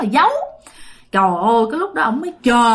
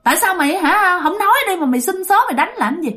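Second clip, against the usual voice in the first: indignant high-pitched rebuke; scolding; 6 semitones higher than usual. Acted. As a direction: demand an answer, exasperated. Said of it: "la nạt".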